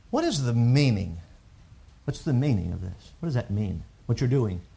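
quietly voiced child asking what it means; a hushed, wondering question; soft child's question. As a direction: ask it softly like a puzzled child. Voicing a curious child's question.

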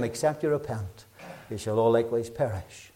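soft solemn scripture warning; hushed repeated warning. Shown as a demonstration. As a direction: say it again quietly for weight. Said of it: "Just in case we missed it".

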